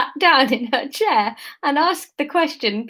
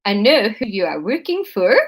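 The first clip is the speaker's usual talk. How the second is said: wobbly, put-on Dutch accent; bad Dutch accent; 2 semitones lower than usual. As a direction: question her in a clumsy Dutch accent. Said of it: "integral to my Dutch accent".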